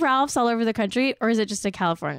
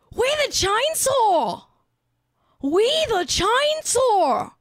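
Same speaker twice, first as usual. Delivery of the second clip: hammed-up Australian accent, high and drawn out; 8 semitones higher, slower than usual. Shown as a demonstration. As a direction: lay the Aussie accent on thick, climbing higher and slower at the end. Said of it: "Let me say that in an Australian accent".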